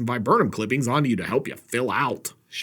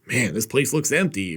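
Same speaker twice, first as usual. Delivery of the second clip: usual speaking voice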